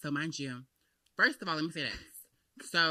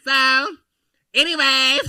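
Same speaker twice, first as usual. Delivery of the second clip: high, loud topic change; loud deflection; 10 semitones higher, 17 dB louder, slower than usual. Real, not acted. Guest steers away from talk of the man, loud and high. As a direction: shout it to cut the subject off.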